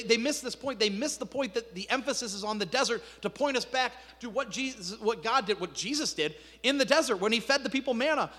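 ordinary talk, nothing marked

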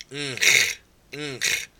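nch said twice as a nasal n into ch; nch sound. Shown as a demonstration. Teacher answering own question by voicing nch. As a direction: demonstrate nch. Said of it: "Can you make that sound?".

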